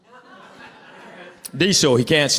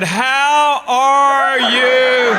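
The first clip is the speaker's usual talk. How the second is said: overdone greeting; 7 semitones higher, 4 dB louder, much slower than usual. Acted. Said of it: "greeting the old teacher".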